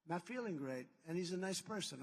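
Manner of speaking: ordinary talk, nothing marked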